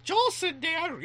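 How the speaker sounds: put-on very high, loud kid voice; correcting kid. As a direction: correct your dad in a piercingly high child's voice. Kid corrects his dad, acted.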